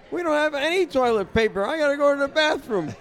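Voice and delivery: high whiny plea; high pleading voice. Retold or imitated. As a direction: voice her like a desperate plea. Ada's plea voiced, pitched up.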